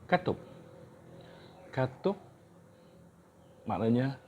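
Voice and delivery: normal talking voice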